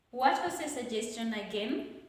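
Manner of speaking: "again" rising to seek clarification; rising question intonation. Shown as a demonstration. Teacher reads a question seeking clarification, with rising intonation. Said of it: "will have the rising".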